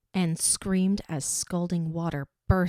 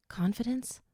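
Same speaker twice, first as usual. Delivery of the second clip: choked, breathless whisper; tight, choked whisper; 6 semitones higher than usual. A narrator's reading. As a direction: barely get the word out, choked. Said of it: "utter in a tight, choked voice".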